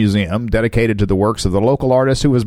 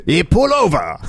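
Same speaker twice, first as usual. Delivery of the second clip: put-on lightly accented pull over; mock German policeman; 5 semitones higher than usual. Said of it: "Why did he become German".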